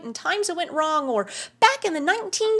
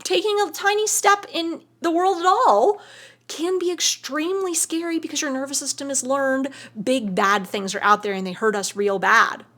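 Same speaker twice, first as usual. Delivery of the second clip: normal talking voice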